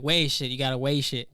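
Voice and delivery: "weish" said as waysh both times; imitated mispronunciation. Mimicking the South Jersey man, "weish" said as waysh. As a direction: put an ay vowel in each "weish". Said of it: "he's like".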